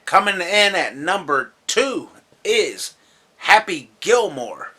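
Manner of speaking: emphatic ranking announcement; countdown announcer. Real, not acted. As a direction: draw out the big countdown reveal. Countdown announcement of next entry.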